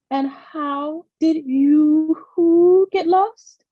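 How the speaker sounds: high, very slow hooting owl; a wise owl drawing out her words. A narrator's reading. Mother Owl asks Grace.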